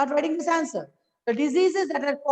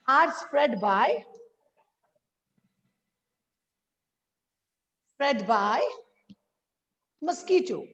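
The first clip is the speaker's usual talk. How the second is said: slow dictation; slower than usual. Real, not acted. Teacher dictating the answer to pupils, slow and broken up. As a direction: dictate in short chunks with long pauses.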